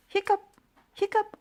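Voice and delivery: high squeaky hiccup noises; monster hiccups. Acted. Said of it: "the monster had hiccups".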